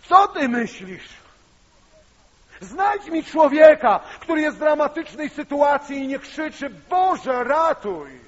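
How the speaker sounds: high, loud, slow desperate cry; anguished outcry. Retold or imitated. Sister cries back at her brother, desperate shout. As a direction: hurl it back at him in despair.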